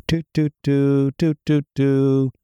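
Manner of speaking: slow, high sing-song toots; mock happy toot. Host celebrating reconciliation to listeners, sing-song toots. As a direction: toot out a cheerful little tune. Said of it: "We're friends again".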